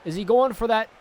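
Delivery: building to a loud, high climax; rising hype as the finisher comes; excited play-by-play. Acted. Live wrestling play-by-play.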